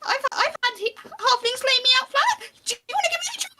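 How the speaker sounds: squeaky falsetto challenge; burly dwarf with a tiny high voice; high-pitched dwarf voice. Acted. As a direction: pipe it high and friendly. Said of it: "says in a very high-pitched voice".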